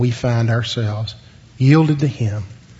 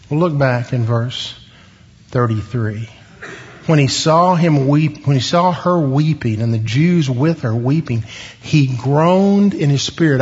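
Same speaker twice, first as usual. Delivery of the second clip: normal talking voice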